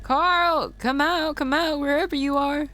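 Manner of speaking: high, lilting come out wherever you are; high teasing sing-song call. Acted. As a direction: coax him out like a game of hide and seek. Calling for hiding Carl.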